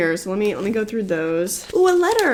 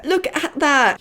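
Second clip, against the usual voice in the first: laughing; 6 semitones higher, slower than usual. Real, not acted.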